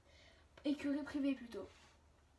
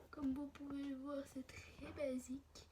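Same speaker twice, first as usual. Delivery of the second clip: whispering; 7 dB quieter than usual. Real, not acted. Aside while filming.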